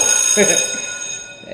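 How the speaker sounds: breathy chuckle at his own point; real delighted laugh; amused laugh. Real, not acted. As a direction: laugh it off, pleased.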